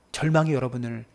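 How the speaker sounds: usual speaking voice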